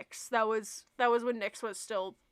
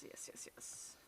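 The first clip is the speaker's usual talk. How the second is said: whispering; 20 dB quieter, slower than usual. Real, not acted. Soft aside to herself.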